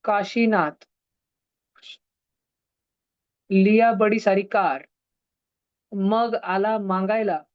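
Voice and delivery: slow, spaced-out mnemonic phrases; slow recital. Shown as a demonstration. Teacher reciting a memory trick for the recording, slowly. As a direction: say it slowly, pausing after each phrase. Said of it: "धीरे धीरे बोल देता".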